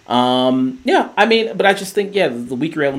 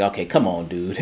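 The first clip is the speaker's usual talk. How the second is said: lower, weary giving-in; sighed mock resignation, dropping softer at the end; resigned Steve; 4 semitones lower, 4 dB quieter than usual. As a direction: imitate someone reluctantly going along. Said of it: "He just gives a big sigh".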